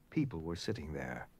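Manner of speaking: unvoiced hush over the words; whispered narration. A narrator's reading. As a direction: breathe the line like a secret.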